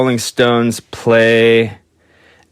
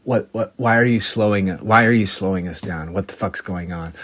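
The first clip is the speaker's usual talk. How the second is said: lowered mock voice; 2 semitones lower, 6 dB quieter than usual.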